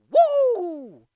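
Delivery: high, loud "woo"; loud whoop. Retold or imitated. Imitating the sportscaster after the fatality, loud whoop. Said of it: "who literally said, Woo!".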